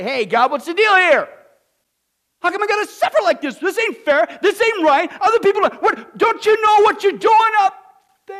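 loud, high-pitched mock griping at God; hammed-up shouting complaint, quick and high; mock complaining. American churchgoer griping at God, hammed-up outrage. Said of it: "like you hear in the American church".

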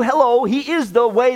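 ordinary talk, nothing marked

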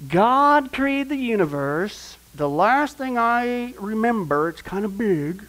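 slow, drawn-out, starting high and easing down; slow understatement. Real, not acted. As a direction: stretch it out as if stating the obvious. Slow, drawn-out wry statement.

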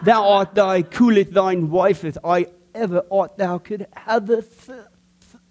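slow, hammed-up old English lover; mock King James speech. Pastor mock-addressing his wife in archaic English, slow and hammed-up. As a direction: drawl it like a mock Shakespeare suitor. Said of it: "I immediately started with this".

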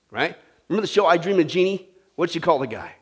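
ordinary delivery

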